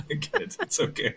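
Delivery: airy breathless laugh through the words. Real, not acted. Laughing to her co-host about bad takes, light breathy laugh. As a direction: laugh the words out, half-voiced.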